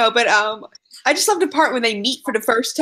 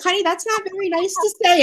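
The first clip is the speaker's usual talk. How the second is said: higher-pitched pretend mom voice; mock chiding mom; 6 semitones higher than usual. Mom playfully correcting her cursing kid, mock chiding. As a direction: lightly chide a child, pitched up. Voicing a scolding future mom.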